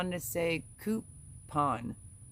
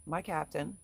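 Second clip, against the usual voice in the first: usual speaking voice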